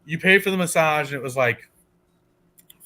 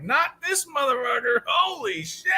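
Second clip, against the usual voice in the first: much higher half-shouted curse; reacting to the masseuse's excuse, high mock shout; 9 semitones higher, 3 dB quieter than usual. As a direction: blurt it out in disbelief.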